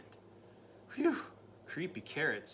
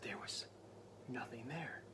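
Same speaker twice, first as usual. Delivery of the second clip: whispered, holding tension; hushed whisper; 3 semitones lower, 10 dB quieter than usual. A narrator's reading. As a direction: whisper it, nothing's there. Suspense narration.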